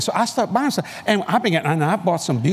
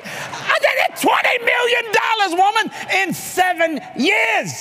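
mad shout; slower than usual. Retold or imitated. Telling the audience how mad he was at his wife, shouting high and slow. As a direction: rail at her with a laugh underneath. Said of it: "I was so mad at Kathy".

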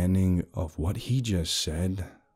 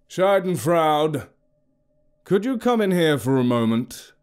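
loud but polite call; 7 semitones higher than usual. A narrator's reading. Villain calls his servant in, loud polite call. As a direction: summon her politely but loudly. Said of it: "he asked loudly but politely".

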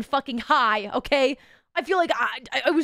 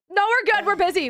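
hasty refusal; 7 semitones higher, 4 dB louder than usual. Retold or imitated. Refusing instructor's offer.

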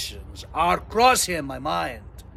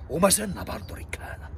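whisper; 6 dB quieter than usual. Acted. Aside in the mock-heroic tale.

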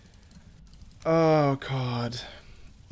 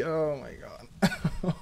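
mock sob; 7 dB quieter, much slower than usual. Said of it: "cry a bit more".

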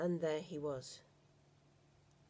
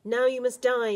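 loud, higher threatening shout; a gunman shouting a threat; 5 semitones higher, 10 dB louder than usual. A narrator's reading. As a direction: yell it as a death threat. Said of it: "he shouted".

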